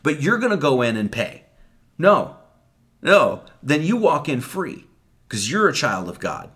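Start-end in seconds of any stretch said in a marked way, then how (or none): 2.0-3.4 two sharp, loud, clipped noes; firm loud refusal to paying the tax; emphatic no. Real, not acted. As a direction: shut the idea down hard.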